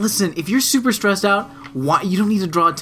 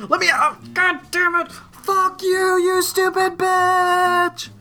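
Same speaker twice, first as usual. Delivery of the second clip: high, hammed-up rant, last words dragged slow; mock furious rant; 13 semitones higher, much slower than usual. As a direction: shriek curses at the bagger in pretend rage. Acting rant at bagger mid-air.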